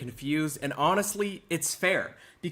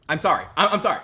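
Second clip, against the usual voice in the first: hammed-up, louder apologies; loud mock sorry; 4 semitones higher, 7 dB louder, faster than usual. Streamer loudly overplaying apology to viewers.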